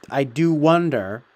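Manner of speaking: slow I do wonder with weight on wonder; stress on wonder. Real, not acted. Vlogger to his audience, slow with wonder stressed. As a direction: lean hard on the word wonder, slowly. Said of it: "I stress the word wonder".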